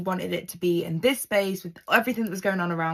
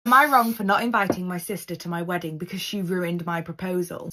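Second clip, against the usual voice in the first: ordinary delivery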